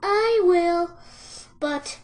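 high, drawn-out piglet voice; high little-pig voice, breaking off; sighing piglet. A narrator's reading. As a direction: agree reluctantly, then hesitate. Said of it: "sighed the piglet".

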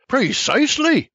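high, exasperated shout. A narrator's reading. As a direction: throw out Precisely in high exasperation. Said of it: "Exasperated, I shouts".

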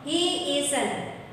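ordinary talk

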